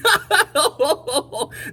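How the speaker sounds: loud shaking laugh; big laugh. A narrator's reading. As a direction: laugh hard. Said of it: "shook from his head".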